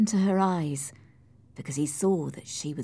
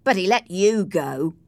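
louder, cutting retort; scornful jab; 2 semitones higher, 6 dB louder than usual. Acted. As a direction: throw it back with contempt. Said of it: "Heathcliff said scornfully".

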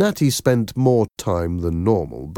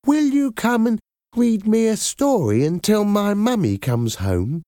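put-on little girl voice. A narrator's reading. Natty voiced as child.

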